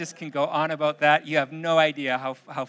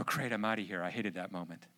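lower, softer muttered relief; relieved parent aside; 7 semitones lower, 9 dB quieter than usual. Retold or imitated. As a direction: sigh it out as a fed-up parent. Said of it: "and you go".

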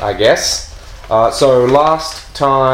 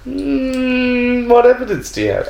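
sceptical official; 14 semitones higher than usual. Acted. GM voices a doubting official.

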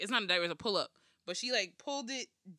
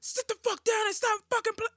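high, sharp mock-furious order; serious yell; 12 semitones higher, 4 dB louder than usual. Mom finally gets serious with kids, high mock-angry yell. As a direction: let rip like a parent who means it. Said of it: "when I get serious".

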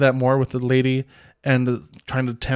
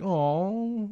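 soft aww; 7 semitones higher, 5 dB quieter than usual. Real, not acted. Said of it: "I was like".

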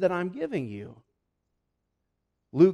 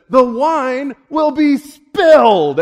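slow, much higher shout; dramatic proclamation; 9 semitones higher, 15 dB louder, much slower than usual. Acted. Jesus warning the crowd, slow loud shout. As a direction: boom out the warning slowly and loudly. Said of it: "he says".

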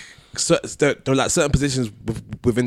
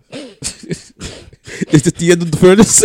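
laughing; 7 semitones higher, 10 dB louder, slower than usual. Real, not acted. Mock dramatic question while laughing.

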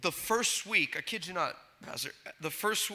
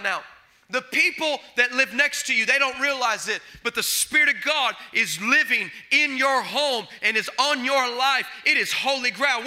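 loud, raised preacher's cry to the congregation; full-force preaching; 5 semitones higher, 5 dB louder than usual. Acted. Pastor preaching to the church in a loud, rising voice. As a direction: preach it out, pushing every line.

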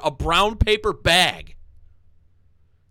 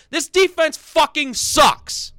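higher and louder, slow, spitting each word; furious outburst; 7 semitones higher, 4 dB louder, slower than usual. Real, not acted. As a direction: blow up at the defense. Fan ranting about his team's defense.

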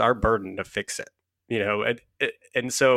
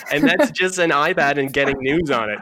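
laughing through the words; 4 semitones higher, 5 dB louder than usual. Real, not acted. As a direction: talk while still laughing. Laughing at friend's teasing.

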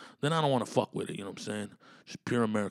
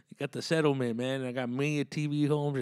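Chicano homie voice; 3 semitones higher than usual. Pretend.

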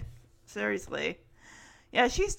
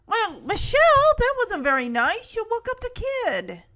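high, emphatic retold telling-off; mock scolding; 10 semitones higher, 4 dB louder than usual. Uncle scolding Michelle for waking the baby, mock scolding. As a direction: chide a child, leaning hard on the name. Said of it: "And Jesse scolds her".

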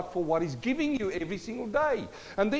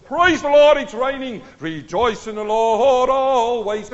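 loud, high, exultant; joyful praise; 4 semitones higher, 12 dB louder, slower than usual. Retold or imitated. Believer praising God at the rainy window, loud and exultant. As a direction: shout praise at the rain.

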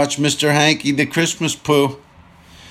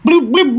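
high, louder bloop beeps; imitated timer beeps; 11 semitones higher, 3 dB louder than usual. Telling listeners about the car wash timer, high bloop beeps. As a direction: chirp it like a machine turning on.

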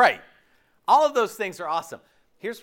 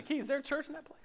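mock naive question; 9 semitones higher, 12 dB quieter, faster than usual. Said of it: "the question people ask me".

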